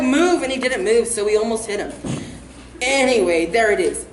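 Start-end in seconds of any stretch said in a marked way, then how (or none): 0.0-0.4 yelling. Retold or imitated. Kids in the truck yelling Move at the jogger.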